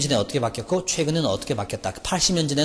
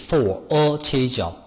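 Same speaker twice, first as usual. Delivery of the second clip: "for a change" stretched very slowly; teacher repeating "for a change" very slowly; much slower than usual. Shown as a demonstration. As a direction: draw "for a change" out word by word. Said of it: "for a change입니다".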